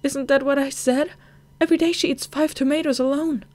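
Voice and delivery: cling to hope against the doctor; desperate pleading. Acted. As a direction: daughter begs doctor that mother will recover.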